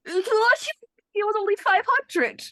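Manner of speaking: high-pitched protest. Acted. Protesting the amount owed.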